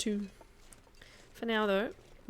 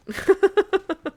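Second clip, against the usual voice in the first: loud, high burst of laughter; real laughter; 12 semitones higher, 12 dB louder than usual. Real, not acted. Amused at own German accent attempt.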